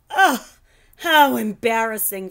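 loud groan of embarrassment. A narrator's reading. Phoebe groaning about landing in his hair. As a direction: groan it out, mortified. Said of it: "Phoebe groaned".

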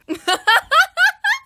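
high, loud burst of laughter. Real, not acted. Singer laughing off a failed note, high laugh. Laughs after a botched take.

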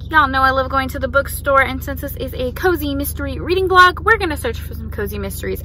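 ordinary talk, nothing marked

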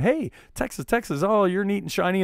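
mock-flirty high call; flirty sing-song. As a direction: toss it off like a playful cheer. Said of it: "I literally went".

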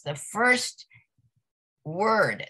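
normal talking voice

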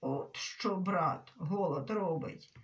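low, dull voice; dull, muffled remark. A narrator's reading. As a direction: drop it low and blurt it dully. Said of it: "Свита глухо бовкнула". (глухо, низьким голосом)

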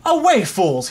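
high, loud angry shout; a king shouting at his magicians. Acted. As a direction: drive them out with a yell. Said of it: "he shouted".

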